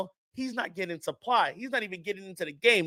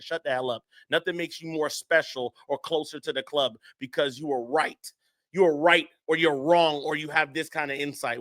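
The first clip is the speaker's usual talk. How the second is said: usual speaking voice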